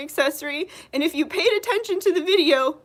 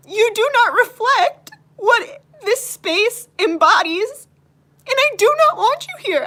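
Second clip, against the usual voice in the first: tearful firmness; 3 semitones higher, 5 dB louder than usual. Real, not acted. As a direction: stand your ground through tears. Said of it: "That's why I'm crying right now".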